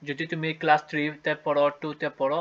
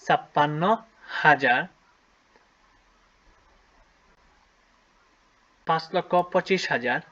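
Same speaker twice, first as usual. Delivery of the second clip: slow, drawn-out dictation with long pauses; dictating slowly; slower than usual. Shown as a demonstration. Teacher dictating to pupils, slow with long pauses. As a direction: spell out each part of the number slowly for the pupils. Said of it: "লিখে ফেলি".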